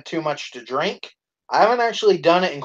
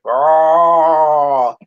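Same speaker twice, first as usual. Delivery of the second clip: long drawn-out mock roar, loud; goofy human dinosaur roar; imitated dinosaur roar; 5 dB louder than usual. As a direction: roar like a pretend T-Rex. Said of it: "next thing you hear is".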